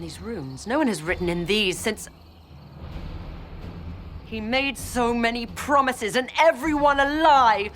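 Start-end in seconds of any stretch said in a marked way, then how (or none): 4.2-7.7 raised, anguished pitch; high, impassioned outcry of betrayal; betrayed outburst. Acted. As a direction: let the anger at being deceived ring out. Betrayed follower learns the truth.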